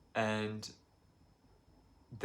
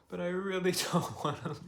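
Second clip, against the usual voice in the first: a tearful laugh breaks into the words; laughing through tears; 8 semitones higher than usual. Real, not acted. Vlogger laughing through tears about not wanting to leave Italy. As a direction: laugh it off so you don't cry. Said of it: "I have to laugh so I don't fucking cry".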